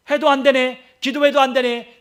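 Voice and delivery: high, loud voicing of a doubter; hammered-out imitation of giving up; loud mock complaint. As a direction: call out the imagined believer's frustrated complaint.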